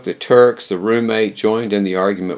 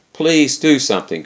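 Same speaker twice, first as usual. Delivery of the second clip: pitched-up, lightly urgent plea; a mild cry for help, higher than narration; 6 semitones higher, 3 dB louder than usual. A narrator's reading. The cook appeals to the roommate and me, mildly crying out. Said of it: "cried the cook".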